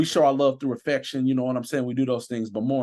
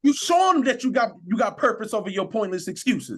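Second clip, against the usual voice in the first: preaching; 7 semitones higher than usual. Acted. Officer preaching to listeners about speaking life, fervent.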